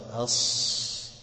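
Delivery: أص drawn out as a long breathy hiss; voiceless emphatic s. Shown as a demonstration. Teacher demonstrating breathy emphatic s. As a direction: hold the air on أص. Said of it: "مثلًا الصاد".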